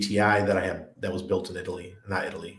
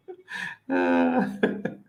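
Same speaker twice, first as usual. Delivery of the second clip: startled laugh. Real, not acted. Spider dropped from the ceiling.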